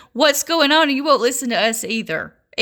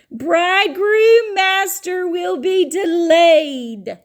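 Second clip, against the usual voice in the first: raised, drawn-out insistent preaching; emphatic preaching; 5 semitones higher, slower than usual. Real, not acted. Preacher insisting to doubters that scripture says delayed, high emphatic delivery. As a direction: pitch it high and stretch the key words.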